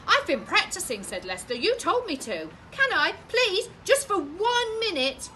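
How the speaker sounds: put-on high, loud begging boy; boy begs mum to let him play; pleading child. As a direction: beg like a child who wants his turn.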